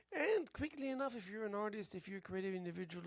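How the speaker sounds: sudden high, loud burst; excited. Real, not acted.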